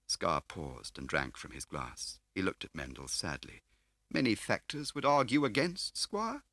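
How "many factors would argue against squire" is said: smarmy dealer playing grand; oily fat car dealer. A narrator's reading. Scar's voice held.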